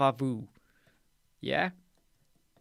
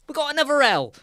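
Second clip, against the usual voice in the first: high, loud exasperated outburst; mock disbelief; 11 semitones higher, 5 dB louder, faster than usual. Namer finding another L, high mock disbelief. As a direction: act thrown by yet another letter. Namers acted despairing at letters.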